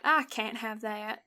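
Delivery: drawn-out Southern twang; Southern accent. Shown as a demonstration. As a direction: repeat it slowly in a Southern US accent. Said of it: "went southern for that".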